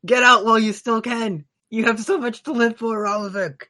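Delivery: high urgent heroic plea; heroic strongman voice. Acted. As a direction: urge a friend to flee. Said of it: "booming voice".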